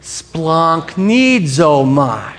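"Splunk needs oh my" stretched extremely slowly; demonstrated pronunciation. Shown as a demonstration. Preacher has congregation try it, "Splunk needs oh my" very slow. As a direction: draw out every syllable of "Splunk needs oh my". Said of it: "Try it one time".

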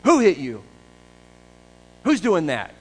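loud jeering taunts at a prisoner; the guards loudly taunting Jesus, mocking shout. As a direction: jeer at him like a cruel guard. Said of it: "and they mock him".

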